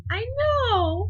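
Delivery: very high, squeaked reply; squeaky. Retold or imitated. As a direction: chirp it in a tiny high voice. Squealing reply to her crush.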